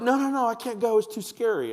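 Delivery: high, loud mock-scared child; hammed-up childish panic; panicky kid. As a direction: refuse like a frightened little kid. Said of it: "they'll be like".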